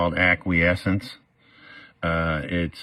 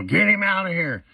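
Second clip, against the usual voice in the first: mock judge order; 12 semitones higher than usual. Judge orders bailiff to remove him, mock high command. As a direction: imitate the judge throwing him out.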